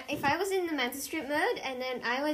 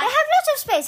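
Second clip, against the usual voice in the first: loud, high-pitched protest; insistent; 13 semitones higher, 7 dB louder than usual. Real, not acted. Arguing with a friend about the garden spades, insistent. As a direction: push it out louder and higher to be heard. Repeats over the other speaker.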